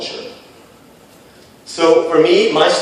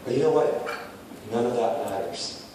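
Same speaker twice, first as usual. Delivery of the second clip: quiet; 13 dB quieter than usual. Real, not acted. Sober turn in speech.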